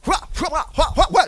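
ordinary talk, nothing marked

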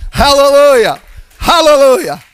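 loud, ringing praise shout; shouted hallelujah. Real, not acted. Preacher rallying the congregation with a loud shout. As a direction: lift the room with it.

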